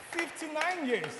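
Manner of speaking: impressed announcement. Real, not acted. Preacher announcing a couple's long marriage to the congregation, impressed. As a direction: proclaim it to the whole congregation.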